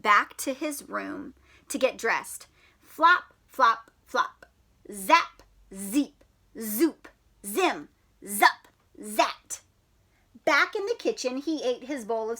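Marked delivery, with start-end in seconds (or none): none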